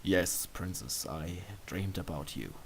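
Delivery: quiet bashful confession; embarrassed admission. Acted. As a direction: confess it shyly. Embarrassed admission after a sigh.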